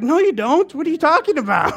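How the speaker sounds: high, loud, laughing disbelief; laughing protest. Retold or imitated. His head refusing the spirit, high laughing protest. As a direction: scoff through laughter. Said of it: "my head was like".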